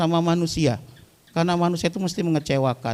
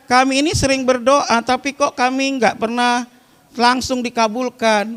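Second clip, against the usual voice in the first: congregation's complaint; 7 semitones higher, 4 dB louder than usual. Acted. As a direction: ask it loud as if you were the puzzled congregation. Voicing the congregation's question. (menirukan pertanyaan jemaah dengan suara lebih tinggi dan keras)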